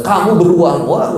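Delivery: high, mock retort; playful acted comeback; wife snapping back. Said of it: "ibu nggak usah balas".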